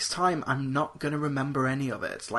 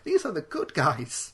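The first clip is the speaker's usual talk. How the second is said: ordinary delivery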